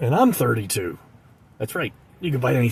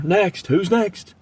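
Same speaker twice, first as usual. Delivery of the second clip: mock yell, higher pitched; deli yell; 6 semitones higher, much slower than usual. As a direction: call out to the next customer. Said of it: "he yells, what does he yell".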